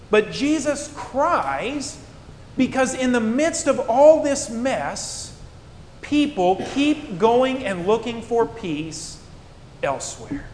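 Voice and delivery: slow right down and plead the point; a preacher's slow, lifted, heartfelt appeal; slow impassioned plea. Acted. Sermon climax on why Jesus cries.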